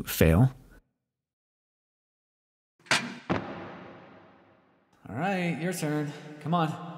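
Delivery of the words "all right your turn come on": distant, much softer call from below; far off. Acted. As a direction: call up from the foot of a ladder. Calls up from below the ladder.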